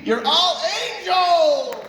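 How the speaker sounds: joyful shout. Real, not acted. Host's final shout to crowd.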